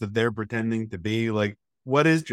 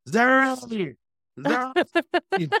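Luther impression; 14 semitones higher, much slower than usual. After watching Luther, mimicking the show: high bellowed call. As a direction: call out the name like the show's hero. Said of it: "I'm just going".

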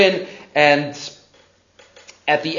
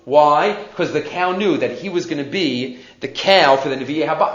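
ordinary talk, nothing marked

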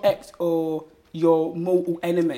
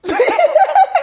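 sudden loud, high laugh; 12 dB louder than usual. Real, not acted. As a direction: cackle in surprise. Awkward question makes him laugh.

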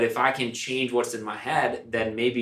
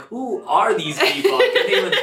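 baffled newcomer; 12 semitones higher, 4 dB louder than usual. Retold or imitated. Retelling a confused new listener, mock-baffled and laughing. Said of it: "they're like".

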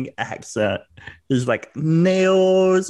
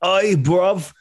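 cheeky cockney mock British accent; hammed-up London lad impression; 4 semitones higher than usual. Mocking Andrew Tate's podcast clip, in a hammy British accent. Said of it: "That's your worst meme that you do".

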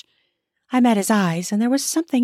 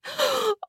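high sudden gasp of shock; sharp gasp; 6 dB quieter than usual. A narrator's reading. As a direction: catch your breath in surprise. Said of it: "I gasped".

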